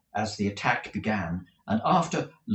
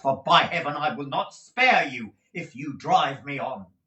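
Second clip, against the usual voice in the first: furious threat; 5 semitones higher, 4 dB louder than usual. Acted. Nicholas threatens Squeers.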